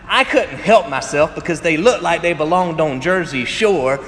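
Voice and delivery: ordinary delivery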